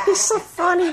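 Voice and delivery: high sing-song pet talk. Real, not acted. Talking to pet bird, high voice.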